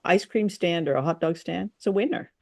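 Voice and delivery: ordinary delivery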